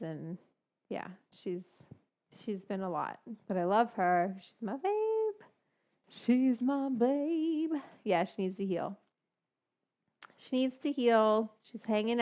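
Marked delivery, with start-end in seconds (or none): 4.4-5.3 high doting pet voice. Real, not acted. Cooing over her dog. 6.1-7.7 very slow, stretched-out and fond; long slow adoring croon; slow doting coo. Real, not acted. Cooing over her dog.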